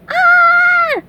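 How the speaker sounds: piercingly high, loud scream; very high held cry; terrified yell. A narrator's reading. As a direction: shriek in cartoon terror. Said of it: "yelled SpongeBob".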